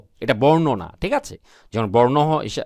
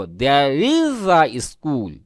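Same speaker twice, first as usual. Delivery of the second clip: "There is a school in our village" read very slowly, each word apart; teacher reading a model sentence slowly; slow dictation read; much slower than usual. Shown as a demonstration. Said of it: "আমরা কি লিখবো".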